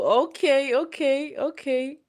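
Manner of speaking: high, bright repeated okays; pleased okays. Real, not acted. Pleased by the viewers' young guesses, high repeated okays. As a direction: savour the compliment, okay okay okay.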